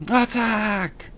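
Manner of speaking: high-pitched, drawn-out "attack" call; mock battle cry.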